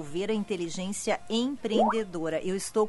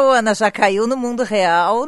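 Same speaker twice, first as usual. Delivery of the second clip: calling out loud; 10 dB louder than usual. Real, not acted. Teasing a colleague on air.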